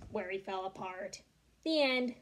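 plain reading voice

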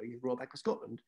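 ordinary talk, nothing marked

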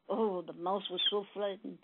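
ordinary talk, nothing marked

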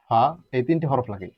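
usual speaking voice

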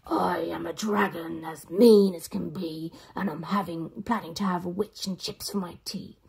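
a hungry dragon savoring its threat; menacing dragon. Acted.

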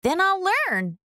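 put-on high, firm little girl; Ruby's spirited comeback, pitched high; determined Ruby. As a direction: say it with stubborn resolve.

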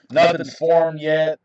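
ordinary talk, nothing marked

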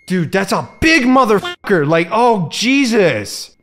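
loud, high horrified shout. Real, not acted. Seeing the giant tarantula, he shouts in horror. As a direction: yell in disgust at the huge spider.

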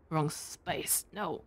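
ordinary talk

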